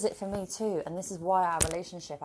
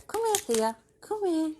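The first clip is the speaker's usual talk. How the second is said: high, louder sing-song call to a pet; calling the dog; 12 semitones higher, 6 dB louder than usual. Real, not acted. Owner calling her dog Thea over, high and louder.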